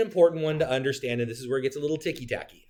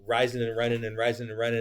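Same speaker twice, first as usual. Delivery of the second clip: usual speaking voice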